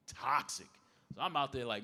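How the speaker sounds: usual speaking voice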